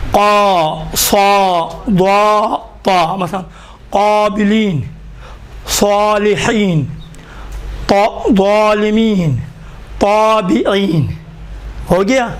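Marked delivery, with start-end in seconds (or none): 0.1-3.1 قا، صا، ضا، طا with thick rounded long vowels; emphatic syllables, each held full-mouthed; heavy alif. Shown as a demonstration. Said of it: "الف بھی موٹا تلفظ ہوتا ہے". 3.9-11.1 slow قابلین، صالحین with thick rounded first vowel; full "aw" after the first letter of ظالمین، طابعین; careful drawn-out recitation of examples; heavy alif. Shown as a demonstration. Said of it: "الف بھی موٹا تلفظ ہوتا ہے".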